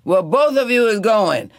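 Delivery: loud, high shouted verdict. A narrator's reading. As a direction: bellow them both out the door. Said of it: "Tea Cake shouted".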